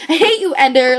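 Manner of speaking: loud, heated telling-off; loud burst of loathing; exasperated outburst. Real, not acted. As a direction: lash out at the character. Tells off hated character.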